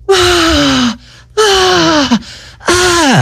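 high excited monkey ahs; high hyper yelps. Acted. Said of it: "calm down, monkey boy".

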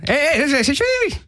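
very high, whistle-like calls; mock whistling catcall. Suggesting whistling replace the Mexican accent, high mock whistle. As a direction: squeal out a pretend street whistle. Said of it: "just get by on whistling".

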